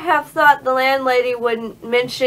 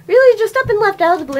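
put-on old lady voice; 8 semitones higher, faster than usual. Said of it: "[name]'s old lady voice kills me".

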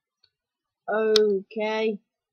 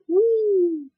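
high excited voicing; high whooping character voice; 6 semitones higher, 3 dB louder, slower than usual. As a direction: cheer as the flying character. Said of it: "he's like".

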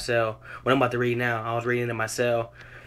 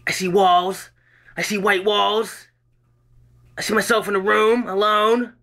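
loud, higher two-voice dramatic reading of a doctor grilling Joe; acted doctor and patient; 8 semitones higher, 6 dB louder than usual. Reading his jail piece aloud, doctor questioning Joe, loud and higher. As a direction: play both doctor and troubled Joe, loud and raised.

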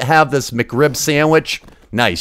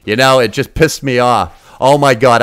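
ordinary talk, nothing marked

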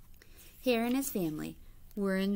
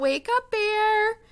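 high, loud and drawn-out call; 11 semitones higher, 9 dB louder than usual. A narrator's reading. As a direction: sing it out loud to wake the bear. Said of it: "Hare called out".

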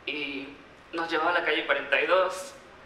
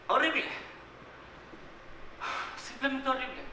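agudo y contenido; confesión dolida; 6 semitones higher, 7 dB quieter than usual. Acted. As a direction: confiesa el dolor con la voz subida de tono. Confiesa el acoso escolar sufrido. (confesión dolida y contenida)

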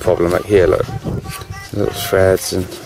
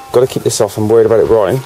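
usual speaking voice

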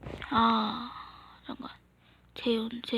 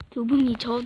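much louder, forceful; loud, exasperated correction; shouted insistence; 10 dB louder than usual. Acted. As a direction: snap it back loudly. Repeated as an outburst.

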